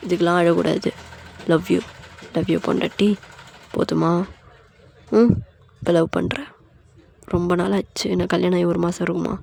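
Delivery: low, intimate murmur; tender love confession. Acted. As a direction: soothe your wife lovingly. Husband soothes and confesses love.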